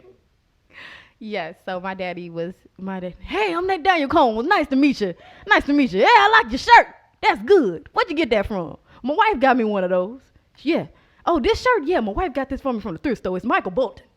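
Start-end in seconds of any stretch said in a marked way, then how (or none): 3.2-8.8 loud, high, hearty mock greeting; booming dad impression. Daughter imitating loud father meeting people, booming. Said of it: "he was so, so loud". 9.0-14.0 loud, raised, proud mock boasting; booming dad impression. Daughter imitating loud father showing off his shirt, booming. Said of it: "he was so, so loud".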